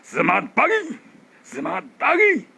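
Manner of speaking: full, supported cheer from the belly. Shown as a demonstration. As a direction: drive "Semangat pagi" from the diaphragm. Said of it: "Ini udah perut". (suara perut yang penuh dan bertenaga)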